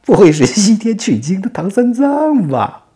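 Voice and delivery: high, loud drawn-out cry; hammed-up loud, high disbelief; mock amazement. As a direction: exclaim it big, stretching it out. Demon king mocks captured monk.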